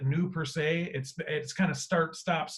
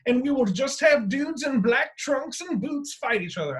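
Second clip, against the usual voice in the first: high, far-off mock Shakespearean declaration; 8 semitones higher than usual. Wrestling fan mocking a blood-oath promise, hammed-up Shakespearean. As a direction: proclaim it like an old Shakespeare player. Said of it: "I don't know why I got a Shakespeare accent".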